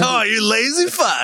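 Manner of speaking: high, slow mock-scolding cry; hammed-up teasing insult; mock outrage. Mock scolding friend's laziness.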